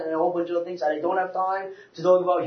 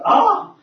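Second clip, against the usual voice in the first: high, loud startled gasp-cry; re-enacted startled yelp; shocked cry; 13 semitones higher, 9 dB louder than usual. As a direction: let out a loud mock shriek of shock. Retold shock in adult store.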